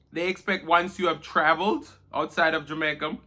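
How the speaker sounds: normal talking voice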